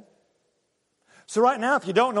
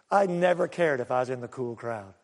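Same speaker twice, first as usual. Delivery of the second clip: ordinary talk, nothing marked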